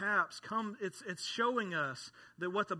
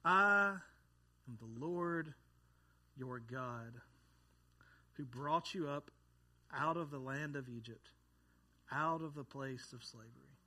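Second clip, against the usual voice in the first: solemn scripture reading; 4 semitones lower than usual. Acted. Pastor reading Exodus to the congregation, hushed and solemn. Pastor reads God's words slowly.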